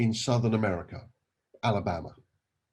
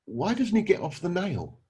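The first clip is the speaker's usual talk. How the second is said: higher, lightly acted bafflement; head-scratching question, pitched up; puzzled salesman; 7 semitones higher than usual. Salesman questions old man about the dog, mildly baffled and higher. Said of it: "the insurance salesman scratches his head".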